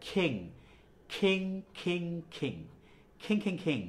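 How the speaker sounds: repeated খিং, puff of air on the k; rhythmic repeats of aspirated খিং. Shown as a demonstration. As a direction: drill খিং with kh each time. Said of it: "যেহেতু খ, এজন্য কি হবে".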